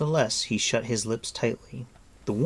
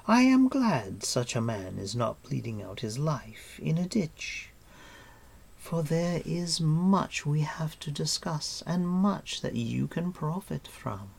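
higher, measured queenly voice; silky queen; 7 semitones higher than usual. Acted. Queen opening a bargain with her captive, silky. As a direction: draw it out with regal composure.

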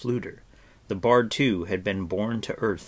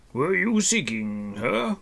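put-on puzzled old hermit; 4 semitones higher, 4 dB louder than usual. A narrator's reading. Old hermit answers Terran's hope, puzzled. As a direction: wonder at it gently.

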